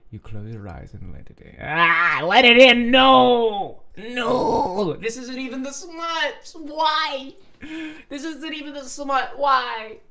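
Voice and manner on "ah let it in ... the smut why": very high, loud cry of protest; flustered wailing. Real, not acted. Reader horrified before even reaching the smut, flustered wailing. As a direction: wail in embarrassed despair. Wails at suggestive line.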